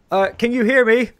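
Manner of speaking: high, loud call down to someone; shouting into a bag. Acted. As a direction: call out to be heard far below. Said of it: "He's shouting into a bag".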